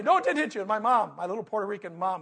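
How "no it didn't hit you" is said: loud contradiction. Retold or imitated. Coach arguing back at kid.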